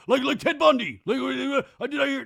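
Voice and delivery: mock excited Jax. Jax eavesdropping on serial-killer talk, eager. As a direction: perk up like a thrilled fan.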